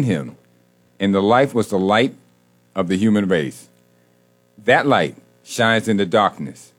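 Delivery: measured, emphatic scripture reading; slow reading. Real, not acted. Host repeating the passage to get it right, reading slowly. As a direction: deliver each verse slowly, pausing between phrases. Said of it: "I'm gonna read it very slow".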